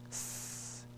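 S as a bare voiceless hiss; just hiss the S; Arabic letter sound demo. Shown as a demonstration. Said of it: "Like a hissing sound".

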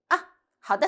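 short falling "ah," of assent; agreeing ah. Shown as a demonstration. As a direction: agree briskly. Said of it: "first means agreement".

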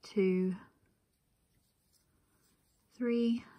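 normal talking voice

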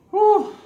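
loud high whew; sharp high exhale. Real, not acted. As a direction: blow it out sharply. Cold ice bath exhale.

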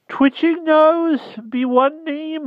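very high, slow, stubborn creature; stubborn hedgehog. Acted. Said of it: "answered the hedgehog obstinately".

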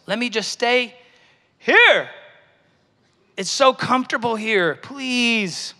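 high-pitched, playful begging; comic whiny plea; mock pleading. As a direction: beg to stay put, voice lifting high. Acting out begging God to stay.

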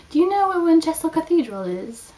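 high, loud wobbly old woman voice, hammed-up; horrible imitation of an old lady. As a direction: imitate the old tourist asking the way badly. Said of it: "I'm sure that was a horrible imitation".

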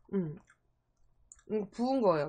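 talking while licking fingers; muffled by fingers at lips; fingers in mouth. Real, not acted. Seen 2: Chewing unclear; from 3.0s fingers are in her mouth, licking.

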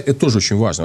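usual speaking voice

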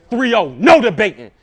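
a hint of Goodz calling the score; mild Goodz-style verdict call; Goods voice. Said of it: "In my Goods voice".

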